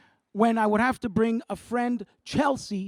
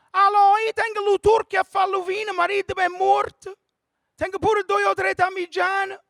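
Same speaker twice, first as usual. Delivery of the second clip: high, loud, shouted caller on a bad phone line; high loud shouting radio caller; old Italian caller; 9 semitones higher, 5 dB louder than usual. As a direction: yell into the phone like a nonna.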